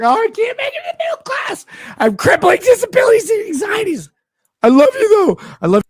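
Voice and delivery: high wailing mock sob; mock crying whine. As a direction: wail it high, fake crying. Mocking crying fan.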